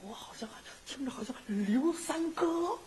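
hushed whisper to a partner; secretive whisper. Acted. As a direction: whisper so nobody hears. Hushed aside while eavesdropping.

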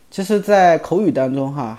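ordinary talk, nothing marked

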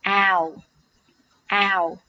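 "ow" said full, with a wide-open mouth; "ow" as a full, isolated vowel demo; full open diphthong. Shown as a demonstration. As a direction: open wide and round out the whole glide of "ow". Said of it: "要饱满一点，".

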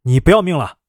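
mild scolding outburst; understated angry rebuke. A narrator's reading. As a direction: tell them off sharply, without shouting. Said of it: "探出脑袋骂道".

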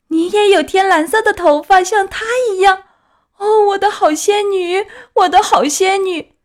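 put-on high, loud, crying child voice; tearful outpouring. A narrator's reading. Puppet begs his long-lost fairy to admit herself, tearful. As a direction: plead through tears, building in intensity. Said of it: "哭得泪如泉涌".

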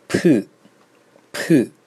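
p, p, p with a hard puff of air; strong aspiration. Shown as a demonstration. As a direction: blast air out on each p. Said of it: "这种发音的送气是吧".

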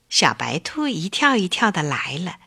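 ordinary delivery

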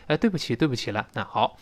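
ordinary delivery

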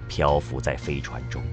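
normal talking voice

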